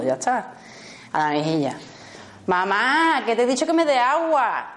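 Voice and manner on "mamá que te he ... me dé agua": put-on higher, insistent kid voice; a kid who's been ignored, pressing; impatient child. Ignored child insists to mother, higher and insistent. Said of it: "y llega el niño".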